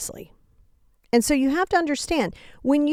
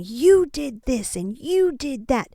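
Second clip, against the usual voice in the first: mock angry accusation; slower than usual. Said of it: "when you're aggressively angry".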